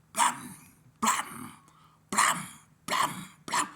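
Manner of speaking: loud, spaced explosive blams; imitated impacts. Storyteller sounding thrown pool balls hitting, explosive blams. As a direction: punch out each blow as a noise.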